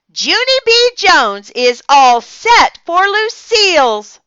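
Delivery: very high, loud joyful shout; squealing joyful little girl; joyful holler. A narrator's reading. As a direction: holler it with pure joy. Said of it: "I hollered, real joyful".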